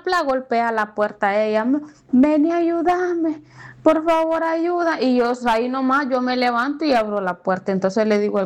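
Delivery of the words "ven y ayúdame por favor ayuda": imitating a slow, high, begging plea for help; desperate plea imitated. Battered neighbor begging the narrator to open the door, high pleading. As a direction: beg at the door, pitched up and drawn out.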